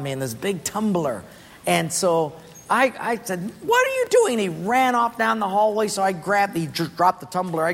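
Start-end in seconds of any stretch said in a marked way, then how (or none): 3.6-4.3 startled yell. Retold or imitated. Soaked by water from above.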